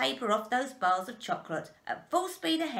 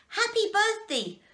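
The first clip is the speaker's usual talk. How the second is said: high, loud birthday cheer; joyful cry; 13 semitones higher, 5 dB louder than usual. A narrator's reading. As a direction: call it out in celebration. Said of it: "cried the four old grandparents".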